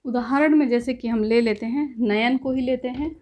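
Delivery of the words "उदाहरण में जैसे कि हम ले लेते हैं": ordinary talk, nothing marked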